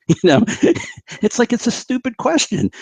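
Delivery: high-pitched words squeezed out through laughter; laughing. Real, not acted. Guest laughing at his own unicorn comparison, giggly.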